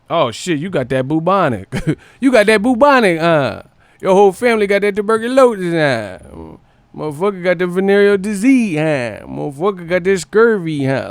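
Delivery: high, loud mocking taunts with laughter; teasing shout. Friend mocking a sick friend over old diseases, loud teasing. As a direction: roast him like a schoolyard bully.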